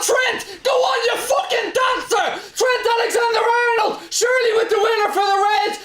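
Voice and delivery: ecstatic goal shout. Real, not acted. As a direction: roar it like your team just won it in stoppage time. Celebrating late winning goal.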